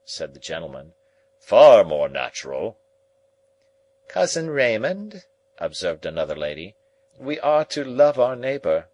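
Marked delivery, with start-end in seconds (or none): 1.4-2.8 very loud, emphatic gentleman; loud, declamatory retort. A narrator's reading. Gentleman continues his retort. 4.1-5.2 high, slow ladylike address; high-pitched voice of a lady relative; reproving lady. A narrator's reading. Another lady reproves her cousin. 7.2-8.9 high, loud ladylike admonition; high-pitched pious reproof; reproving lady. A narrator's reading. Another lady reproves her cousin.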